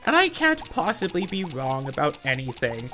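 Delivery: hammed-up know-it-all superiority; mock arrogant. Imitating opponent who claims to be above this, mock arrogant. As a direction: imitate someone lording it over an opponent. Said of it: "act like an arrogant third grader".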